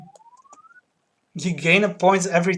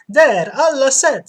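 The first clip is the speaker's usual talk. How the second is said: barber voice; 8 semitones higher, 9 dB louder, much slower than usual. Acted. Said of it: "said the barber".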